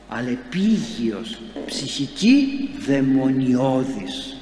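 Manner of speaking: very slow, each word stretched out; slow, grave reading of scripture; drawn-out scripture. Acted. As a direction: let "δαιμονιώδης" hang ominously. Reading scripture with dramatic weight.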